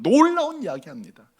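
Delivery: normal talking voice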